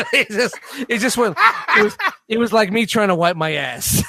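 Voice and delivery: talking through laughter; can't keep a straight face; laughing. Real, not acted. Laughing at the weak swing.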